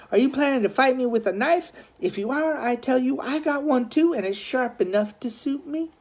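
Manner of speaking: put-on high, fast boyish bravado; a boy bluffing a gang rival with a knife; defiant boy. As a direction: rattle off the knife threat high and quick.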